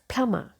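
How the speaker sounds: "plumber" as PLUM-uh; correct plumber. Shown as a demonstration. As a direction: leave the B and R silent in "plumber". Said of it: "Letter B also silent".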